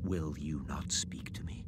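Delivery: hushed, fearful whisper; a frightened whispered question; whispered plea. Acted. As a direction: whisper timidly to the silent ghost. Scrooge before the silent ghost.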